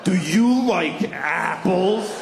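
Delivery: very slow, drawn-out gotcha; big deliberate challenge; taunting question. Acted. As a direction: stretch each word out smugly. The taunt acted again.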